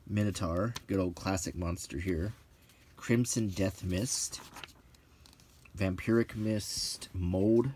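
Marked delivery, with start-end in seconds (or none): none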